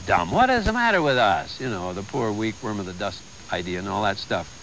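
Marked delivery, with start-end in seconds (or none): none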